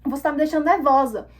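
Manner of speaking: indignant acted telling-off; re-enacted angry retort. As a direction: show exactly how you snapped back. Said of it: "eu falei desse jeito com ela".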